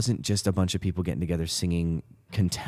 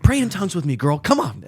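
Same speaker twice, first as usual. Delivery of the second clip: mock urging; 9 semitones higher, 4 dB louder than usual. Acting out an urging to pray.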